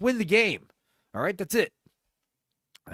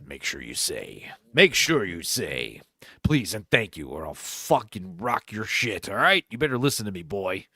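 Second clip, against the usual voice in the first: commanding movie-trailer voice; 6 semitones lower than usual. Retold or imitated. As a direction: threaten like a tough trailer narrator. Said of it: "authoritative voice".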